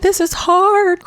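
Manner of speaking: high, loud, drawn-out mock whine; hammed-up tearful wail; mock boohoo crying. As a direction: imitate a crybaby complaining, slow and high. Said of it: "She's still boohoo crying".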